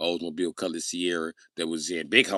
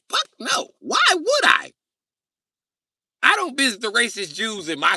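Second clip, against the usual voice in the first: very high, loud incredulous outburst; indignant refusal; 7 dB louder than usual. Real, not acted. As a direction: refuse in outraged disbelief.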